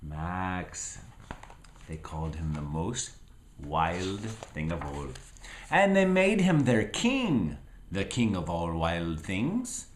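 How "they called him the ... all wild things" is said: plain reading voice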